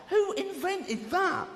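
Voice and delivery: high, incredulous demand; hammed-up appalled rant; comic outrage. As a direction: stretch it out in high disbelief.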